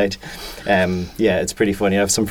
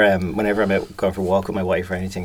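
ordinary delivery

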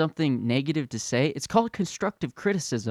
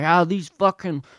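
mock angry patriot; 7 semitones higher, 3 dB louder than usual. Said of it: "then you have 'em being like".

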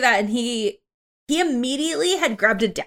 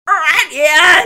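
loud, high kobold shouting; Draconic yelling; 8 semitones higher, 10 dB louder than usual. Acted. Kobold yelling at newcomers in Draconic, loud shout. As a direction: yell it as a foreign war cry. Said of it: "starts yelling in Draconic".

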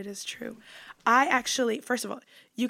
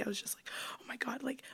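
hushed, breathy suspense; whispering; 10 dB quieter than usual. Retold or imitated. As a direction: whisper it like a secret.

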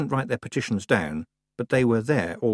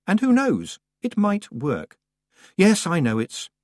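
high wry inner voice; 10 semitones higher, 5 dB louder, slower than usual. A narrator's reading. Tiffany's wry inner thought.

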